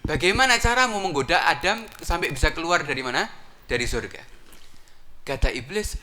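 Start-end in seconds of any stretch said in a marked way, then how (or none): 0.1-4.2 loud, raised eager question; eager followers. Acted. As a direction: press him loudly for the secret. Followers ask how Adam fell.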